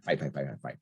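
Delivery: ordinary talk, nothing marked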